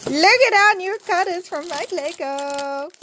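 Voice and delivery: high, excited show-off squeal. Real, not acted. Showing viewers the new cutters, excited. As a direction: gush in a high, lilting voice. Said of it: "I'm so excited to use these".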